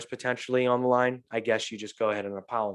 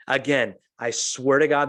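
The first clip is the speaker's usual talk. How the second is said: ordinary talk, nothing marked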